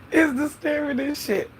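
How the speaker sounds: high-pitched, tickled repeat; amused disbelief. Real, not acted. Repeats it while still amused.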